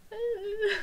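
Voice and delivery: very high, loud whimpering ooh; overwhelmed squeal. Retold or imitated. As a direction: imitate a fan melting with emotion.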